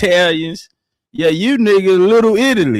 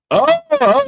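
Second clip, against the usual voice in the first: high squeak of sudden surprise; surprised oh. Real, not acted. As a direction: caught off guard, realising. Sudden realisation.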